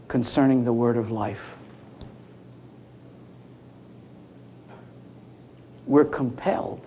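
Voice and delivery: low, hushed, measured quoting; grave, quiet scripture reading with long pauses; solemn recitation. Acted. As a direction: let each phrase sink in, softly and deep.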